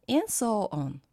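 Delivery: ordinary delivery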